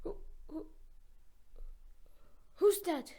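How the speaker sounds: breathless stammer rising to a high yelp; frightened stammer. A narrator's reading. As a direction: stutter it out, scared. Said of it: "stammered Pinocchio".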